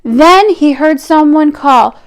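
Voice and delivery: ordinary delivery, nothing marked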